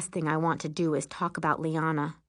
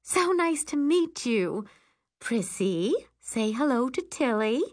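gushing mother; 6 semitones higher than usual. Acted. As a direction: coax a shy child to greet someone. Excited mother coaxes shy child.

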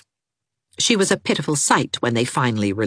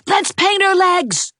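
put-on high, slow, louder boy's cry; high, louder excited boy; gleeful shout; 4 dB louder than usual. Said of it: "shouted Gerald".

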